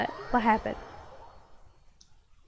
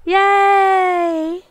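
a bright, loud, high cheer of joy; yay, celebrate the win out loud; 7 semitones higher, 8 dB louder than usual. Real, not acted. Cheering a viewer's new character, excited.

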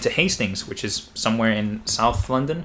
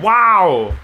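loud high joyful wow; excited shout; 9 semitones higher, 12 dB louder than usual. Real, not acted. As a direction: shout it in disbelief. Just finished medical school.

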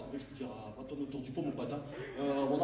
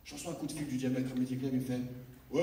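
ordinary talk

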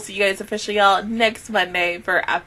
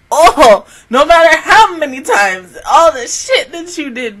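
louder, emphatic ranting; 5 semitones higher, 8 dB louder than usual. Real, not acted. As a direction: vent at the character. Ranting at character.

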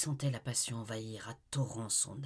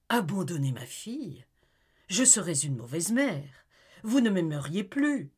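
higher, louder, rising to a cry; indignant mother; 5 semitones higher, 7 dB louder than usual. Acted. Amy refusing to leave her daughter, indignant outcry. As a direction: protest in shock.

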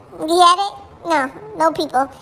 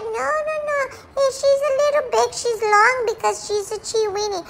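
higher, coaxing imitation of Mom; mimicking mother; 6 semitones higher, 3 dB quieter than usual. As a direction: play Mom brushing off the worry. Dog voicing mother's denial.

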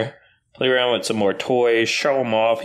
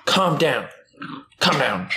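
ordinary talk, nothing marked

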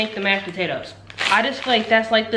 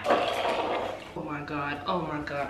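slow, soft exasperated groan; exasperated mutter; 7 semitones lower, 10 dB quieter, much slower than usual. Real, not acted. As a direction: breathe it out in frustration. Struggling to move camera.

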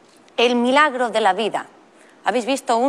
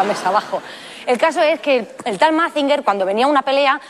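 usual speaking voice

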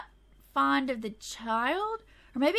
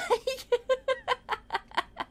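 high, long giggle fit; real helpless high cackling; laughing; 11 semitones higher than usual. Real, not acted. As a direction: laugh until you run out of air. Cracks up at toddler.

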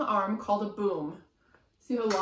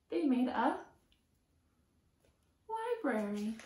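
lively sing-song picture book reading; reading to children; 3 semitones higher, slower than usual. A narrator's reading. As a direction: chant the rhyme to a small child. Reading rhyming picture book aloud.

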